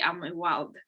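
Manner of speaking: usual speaking voice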